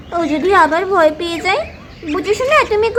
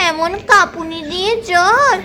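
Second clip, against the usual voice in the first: normal talking voice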